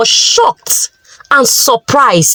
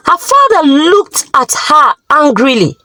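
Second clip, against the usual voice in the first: plain reading voice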